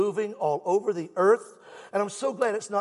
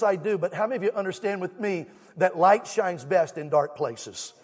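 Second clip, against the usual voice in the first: ordinary talk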